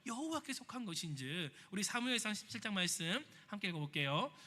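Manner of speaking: ordinary delivery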